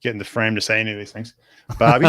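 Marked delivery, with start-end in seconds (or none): 1.8-2.1 Australian Barbie. Shown as a demonstration. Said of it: "Say Barbie".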